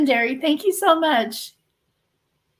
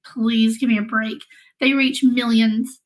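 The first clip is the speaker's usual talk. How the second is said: ordinary delivery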